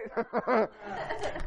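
high breathy laugh. Real, not acted. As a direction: laugh it off at the punchline. Laughs at own story.

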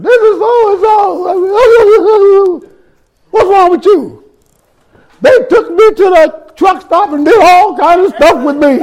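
imitating crying friend. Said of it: "she's crying hysterically".

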